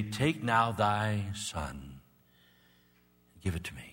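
slow, soft voice of God asking. Pretend. Preacher voicing God, slowly asking for his son. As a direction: draw each word out gently, like God asking. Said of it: "he would come and say".

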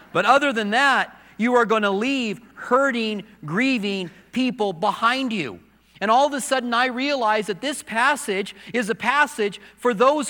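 high, loud, driving sermon voice; preaching at full force. Acted. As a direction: build it up and preach it hard.

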